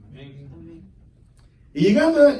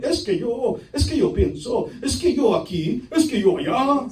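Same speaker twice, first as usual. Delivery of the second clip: put-on excuse-making voice; mock excuses; 4 semitones higher than usual. Servant making empty excuses to the master, mocking imitation. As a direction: mimic a self-important excuse-maker.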